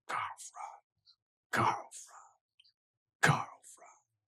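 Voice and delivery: whispered chant of a distant army; mock crowd cheer. Forces of Altdorf cheer their Emperor, imitated as a whispered chant. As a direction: chant it voicelessly, like a far-off crowd. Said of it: "give cheer".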